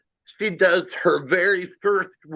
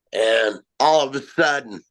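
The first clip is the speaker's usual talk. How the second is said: usual speaking voice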